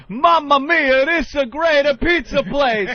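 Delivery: loud high hammy Italian; jolly italian pizza chef. Retold or imitated. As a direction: belt it like a cartoon pizza man. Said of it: "this guy's going".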